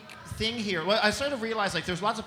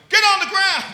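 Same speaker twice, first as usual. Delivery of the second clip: high, very loud shouted order; hammed-up barked command; police command; 14 semitones higher, 14 dB louder than usual. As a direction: yell like a cop making an arrest. Cop orders comic down.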